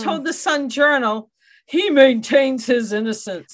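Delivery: imitating a confident defense lawyer; Bill Maselli voice. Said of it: "I can't talk like Bill Maselli".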